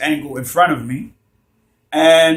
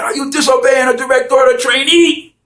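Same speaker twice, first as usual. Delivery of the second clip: much higher, louder drill sergeant shout; top-of-lungs yell; 11 semitones higher, 8 dB louder than usual. Retold or imitated. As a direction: bellow it right into his ear. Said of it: "yelled at the top of his lungs".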